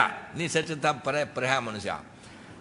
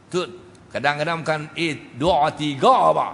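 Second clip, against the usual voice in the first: usual speaking voice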